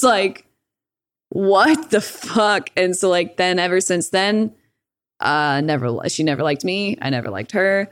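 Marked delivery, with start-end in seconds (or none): 1.3-2.7 drawn-out, very slow "what the fuck"; mock stunned mom; slow disbelief. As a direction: let the disbelief stretch out word by word. Mom reacting to news.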